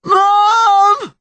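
high, long anguished shout; crying out. A narrator's reading. Connor gripping his mother tighter, anguished cry. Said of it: "he cried".

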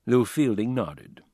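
ordinary delivery, nothing marked

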